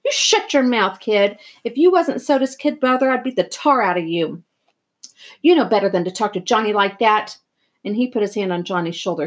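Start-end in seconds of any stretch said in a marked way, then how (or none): 0.1-7.4 angry scolding. Acted. Friend scolding the boy after slapping him, angry. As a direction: tell the kid off hard.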